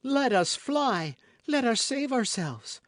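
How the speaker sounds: very high, drawn-out cry of alarm; affrighted plea. A narrator's reading. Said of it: "cried the affrighted Madame Bonacieux".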